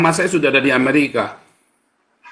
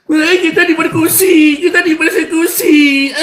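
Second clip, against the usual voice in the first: high, loud fake bawling; exaggerated tearful wail breaking into laughter; mock crybaby whine; 13 semitones higher, 5 dB louder than usual. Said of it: "jangan nangis lah, jangan cengeng lah".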